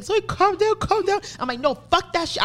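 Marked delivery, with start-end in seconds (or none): 0.0-1.2 high-pitched imitation of a worried plea; urgent high voice, trying to soothe; pleading mother. Mother in the car begging her daughter to calm, imitated. 1.6-2.4 high-pitched retold outburst; going off. Daughter in the car refusing to calm down, going off. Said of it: "I'm going off".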